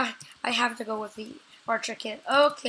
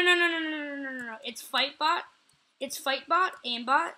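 usual speaking voice

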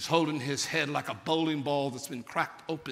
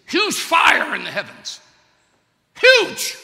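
big rising shout, much higher and louder; preacher's shout; 11 semitones higher, 17 dB louder, slower than usual. Real, not acted. Preacher to congregation, a booming shout. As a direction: cry out the size of the fire. Preacher describing fire from heaven.

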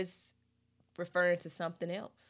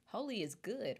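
normal talking voice

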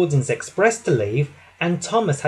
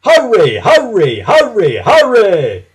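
loud, high repeated calls, each swooping down; gleeful taunting; 5 semitones higher, 9 dB louder than usual. A narrator's reading. Gordon racing poor Thomas along, gleeful loud taunting. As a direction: egg on someone who can't keep up. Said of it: "laughed Gordon".

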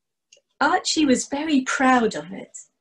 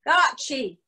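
calling out; 7 semitones higher, slower than usual. Acted. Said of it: "Donald's voice came crying over the hill".

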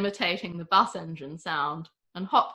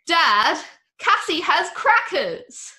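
much higher and louder, slow triumphant telling; tattling shout; 10 semitones higher, 7 dB louder, slower than usual. A narrator's reading. Wilma tells Dad about the hidden bird, tattling shout.

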